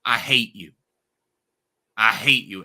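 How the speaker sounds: partly shouted "I hate you"; retold screaming. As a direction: reenact a raw outburst. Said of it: "him screaming at Roman in the ring".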